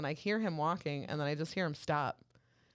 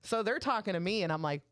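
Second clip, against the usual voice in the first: ordinary talk, nothing marked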